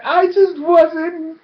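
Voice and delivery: high, hammed-up whiny excuse; pleading lover. Jilting lover excusing himself to her, mock pleading. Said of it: "And he's like".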